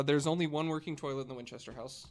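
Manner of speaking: ordinary delivery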